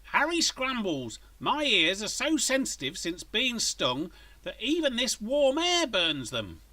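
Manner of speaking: high, slow whining mother's complaint; loud complaint. A narrator's reading. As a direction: nag at Harry over the blow-dryer. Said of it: "to complain loudly".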